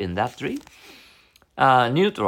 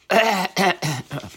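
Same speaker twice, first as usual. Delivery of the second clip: rough cough interrupting speech; coughing fit; 9 semitones higher than usual. Real, not acted. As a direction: cough hard, then apologize. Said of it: "I'm coughing".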